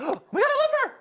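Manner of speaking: high, loud squeal of delight; gushing squeal. Retold or imitated. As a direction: squeal it like a starstruck fan. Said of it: "You were like".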